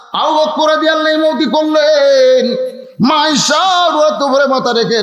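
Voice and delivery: high, chanted sermon delivery; full-force preaching. Real, not acted. Preacher urging the congregation to pay attention, full-force preaching.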